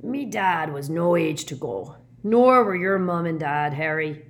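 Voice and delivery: loud, rough-hewn giant's lament; grieving Hagrid. A narrator's reading. Big man mourns parents to Harry, loud grief.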